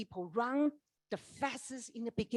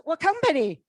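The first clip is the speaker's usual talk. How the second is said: high, loud startled question; surprised question; 8 semitones higher, 12 dB louder than usual. Retold or imitated. A stranger on the plane asks loudly, surprised. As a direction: blurt it out in surprise.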